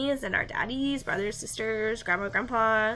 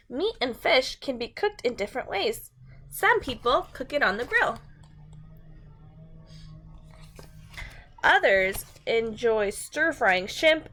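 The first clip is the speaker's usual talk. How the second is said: bright, clear storybook read-aloud; reading to children. A narrator's reading. Teacher reads a food book to her class, storybook voice.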